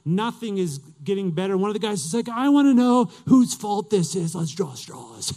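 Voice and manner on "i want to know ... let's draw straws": angry demand. Retold or imitated. Sailor to the crew in the storm, loud acted demand. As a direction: demand the culprit as a fed-up sailor.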